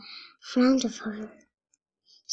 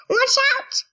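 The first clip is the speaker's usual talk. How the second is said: high sharp warning call; warning cry; 12 semitones higher than usual. A narrator's reading. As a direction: warn him of the attack. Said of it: "cries Mulan".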